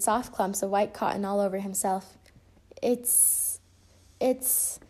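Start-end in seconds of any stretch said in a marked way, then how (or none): 2.8-4.8 hushed, hesitant false starts; struggling for words. A narrator's reading. Friends hunt for a kind word for the costume, hesitant. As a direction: grope for the word, pausing between tries. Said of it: "struggled for a suitable word".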